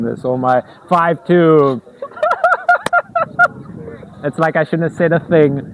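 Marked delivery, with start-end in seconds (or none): 2.0-4.2 high squeal laugh. Real, not acted. Laughs at own miscount.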